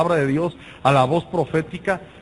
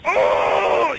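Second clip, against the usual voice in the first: sudden high yelp of pain; mock a sharp ouch; retold cry; 12 semitones higher, 4 dB louder than usual. Preacher reliving the ear cleaning, sharp high cry.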